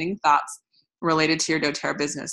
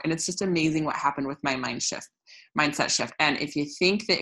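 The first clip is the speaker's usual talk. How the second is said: ordinary delivery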